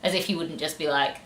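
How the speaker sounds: ordinary talk, nothing marked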